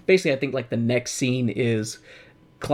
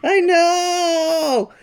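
anguished; 11 semitones higher, 4 dB louder than usual. Real, not acted. Lamenting tragedy.

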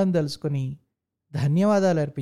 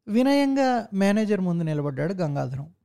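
plain reading voice

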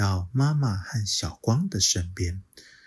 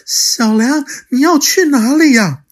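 much louder, higher calling after him; 12 dB louder than usual. Acted. Mother calling to her running child, loud and high. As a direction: call after him across the clearing. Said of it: "叫着他说".

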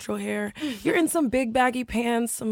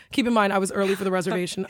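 quick rushed aside; fast aside; 5 semitones lower, much faster than usual. Real, not acted. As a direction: rattle off the aside.

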